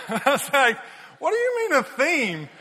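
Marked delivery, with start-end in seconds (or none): 1.2-2.5 giggling. Retold or imitated. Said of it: "I started giggling".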